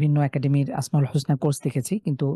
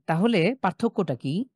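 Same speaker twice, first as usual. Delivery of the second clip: usual speaking voice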